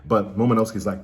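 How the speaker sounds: ordinary talk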